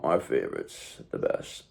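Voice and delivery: a secret compliment for the favorites; hushed, breathy praise; whispered aside. Real, not acted. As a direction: whisper it fondly, under the breath. Fond aside to favorite clients.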